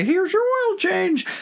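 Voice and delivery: very high-pitched mock-service voice; cheery service worker. Worker handing the car back to a customer, mock-cheery. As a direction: sing it out high like a handover. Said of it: "you give me the car back and say".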